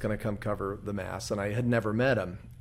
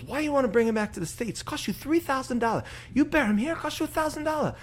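much higher imitation of a Filipino priest's accent; a high-pitched Filipino accent, the punchline set-up; 13 semitones higher than usual. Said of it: "pretty good impression you do there".

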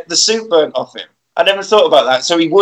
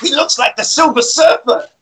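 higher, jeering punk voice, lightly done; mock street punk; 7 semitones higher than usual. Punks jeer at the robot man, light mocking punk voice. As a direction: sneer like a cheesy eighties thug. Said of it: "they say".

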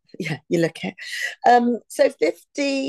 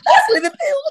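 very high, loud mock Scouse; exaggerated Liverpool accent, right up high; 6 dB louder than usual.

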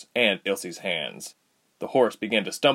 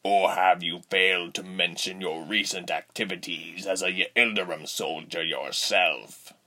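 menacing villain; 2 semitones lower, 3 dB quieter than usual. A narrator's reading. Rubens voiced.

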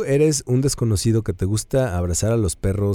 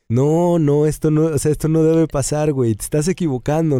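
dog's imagined voice, higher and louder; the dog silently scolds her owner, a raised playful reproach; imagined dog scolding; 7 semitones higher, 3 dB louder than usual. Retold or imitated. Owner voices dog's look after a scolding: higher mock reproach. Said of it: "me vio con cara de".

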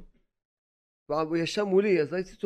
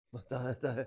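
normal talking voice

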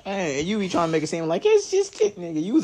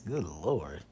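whispered good Lord; 10 semitones lower, 9 dB quieter than usual. Real, not acted. Admiring ex's figure.

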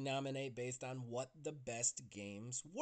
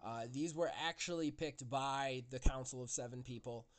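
normal talking voice

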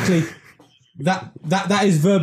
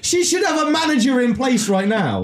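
high, outraged rant about the owner; indignant rant; 7 semitones higher than usual. Real, not acted.

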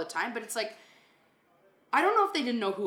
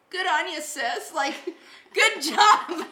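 high, loud laughing praise; laughing through a pumped-up good job; imitating project manager; 9 semitones higher, 7 dB louder, slower than usual. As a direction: cheer her on like a proud colleague. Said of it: "He always says that".

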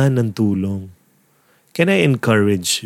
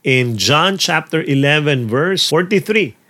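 ordinary delivery

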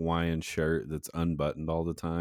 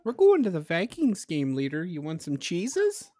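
mock Minnesota accent; 13 semitones higher, 5 dB louder than usual. Mocking Minnesotans.

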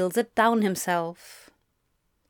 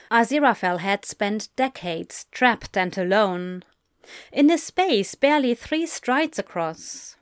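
plain reading voice

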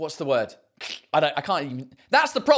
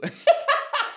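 a sudden, much higher giggling laugh; high burst of laughter. Real, not acted. Laughing at own idea.

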